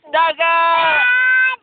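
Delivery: long, held call of "dagat"; shouted demonstration. Shown as a demonstration. Parent counts child in, long call-out. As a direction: call it out and stretch it. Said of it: "Oh, lakas pa".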